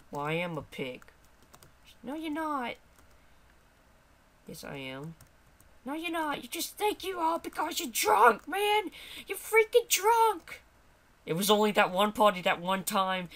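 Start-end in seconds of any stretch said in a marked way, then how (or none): 2.0-2.7 high-pitched little character; high-voiced retort; contradicting sidekick voice. 5.8-10.6 high-pitched character getting worked up; indignant high little squawk; berating sidekick voice. Acted.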